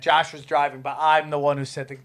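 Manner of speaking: ordinary talk, nothing marked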